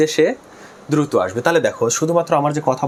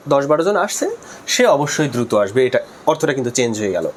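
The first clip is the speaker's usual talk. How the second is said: usual speaking voice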